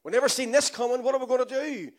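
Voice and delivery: hammed-up worried committee voice; mock panicked God. Preacher mocking a panicked heavenly meeting, hammed-up. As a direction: play God fretting over the virus. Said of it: "Holy Ghost saying".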